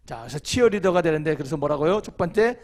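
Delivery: ordinary talk, nothing marked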